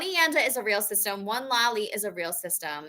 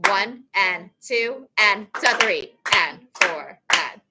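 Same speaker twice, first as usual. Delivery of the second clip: slow, deliberate clap-count; teacher leading a slow count; slow counting demo; 3 dB louder, slower than usual. Shown as a demonstration. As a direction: count each beat slowly and clearly. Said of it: "Here we go".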